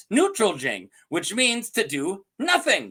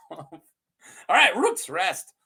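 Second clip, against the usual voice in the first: Rook's Rest said higher and slow; slow, higher excited Rook's Rest; voice goes up; 6 semitones higher than usual. Real, not acted. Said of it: "my voice kinda went up there".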